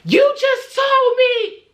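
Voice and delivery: drawn-out, high, loud whiny accusation; high mock outrage. The other girl catching them kissing, mock outraged and high. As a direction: mimic the other girl's shrill disbelief, slowly. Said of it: "she like".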